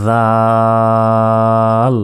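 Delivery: জাল held long with the tongue between teeth; জিহ্বা বের করে জাল; tongue-out dhal, drawn out. Shown as a demonstration. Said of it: "এই অক্ষরটি জিহ্বা বের করে".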